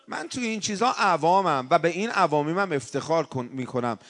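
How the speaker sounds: ordinary delivery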